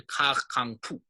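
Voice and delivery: "kar kang pu", stressing both "kar" and "pu"; stress on pu too. Shown as a demonstration. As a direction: give the final "pu" in "kar kang pu" some stress. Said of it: "pu is taken on some stress".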